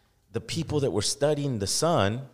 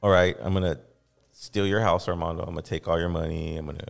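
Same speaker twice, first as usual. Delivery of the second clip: lower, menacing hammed-up villain; mock threat; 8 semitones lower than usual. Host playing a thief talking to Armando, low mock threat. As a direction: list the crimes low and cold. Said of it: "So if I said".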